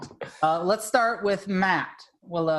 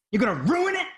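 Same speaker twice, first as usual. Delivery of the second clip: mock outrage; 6 semitones higher than usual. Real, not acted. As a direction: shout it back in disbelief. Mocking the rain excuse.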